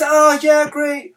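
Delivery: high, loud, gushing praise; hype. Retold or imitated. Friend gushing over a promise to quit, high and loud with excitement. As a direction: cheer the goal way too early. Friend hyping someone's goal.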